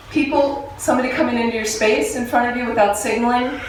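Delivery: normal talking voice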